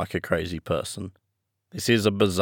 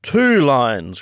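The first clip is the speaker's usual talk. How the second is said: higher, louder acted exclamation; exclaimed in a raised voice; crying out; 5 semitones higher, 5 dB louder than usual. A narrator's reading. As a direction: call it out as an eager correction. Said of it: "cried the Mock Turtle".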